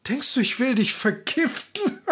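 fake-friendly farmer's wife; disguised peasant woman. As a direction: play along sweetly.

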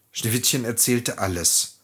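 ordinary delivery, nothing marked

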